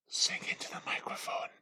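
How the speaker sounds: intense whispered manager impression. Intense manager ordering a worker to sing, whispered. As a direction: whisper it as an overbearing boss would. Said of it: "who's very intense".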